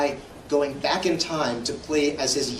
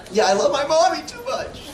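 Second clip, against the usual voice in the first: laughing mommy line; 12 semitones higher than usual. Real, not acted. Admitting love for his mom, high laughing. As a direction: giggle it out sheepishly.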